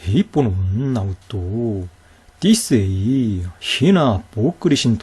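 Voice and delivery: slow, drawn-out reading of Greek; measured teacher's demonstration of Greek; model Greek reading. Shown as a demonstration. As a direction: read the Greek aloud at half speed, vowels stretched. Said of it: "제가 한번 먼저 읽어보도록 할게요".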